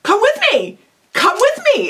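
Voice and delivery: high-pitched, upbeat urging; direct friendly invitation. Shown as a demonstration. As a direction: urge a friend along, simple and direct.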